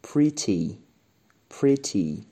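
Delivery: pretty with a short i, stressed first syllable; model pretty. Shown as a demonstration. Teacher modelling pretty for students. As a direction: say pretty as pri-ti. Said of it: "توجه داشته باشین".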